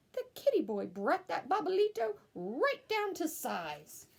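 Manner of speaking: plain reading voice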